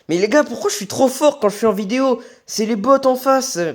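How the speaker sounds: high, loud hype; excited. Real, not acted. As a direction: brag loudly with surprise. Amazed at his own play. (les gars, il s'étonne d'être trop fort, excité)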